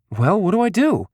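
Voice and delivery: high, insistent question; persistent. A narrator's reading. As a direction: press for an answer, pitch lifted. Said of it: "he persisted".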